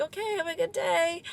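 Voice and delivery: a hint of a chirpy clerk brushing it off; mock breezy goodbye from the waxing lady. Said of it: "she was like".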